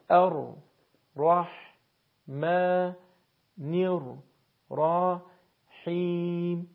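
very slow, drawn-out long vowels; each syllable placed apart on steady beats; syllable-by-syllable recitation. Shown as a demonstration. Teacher repeats verses for learners to practise, slow syllabic recitation. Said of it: "براتون بخش خوانی کردم".

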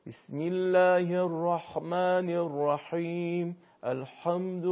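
ح in الرحمن scraped harshly until it sounds like خ; slow recitation with ح in الرحیم mispronounced as rasping خ; wrong over-constricted ḥā. Shown as a demonstration. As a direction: overdo the throat squeeze in الحمد, nearly a خ. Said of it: "یعنی نباید بشه".

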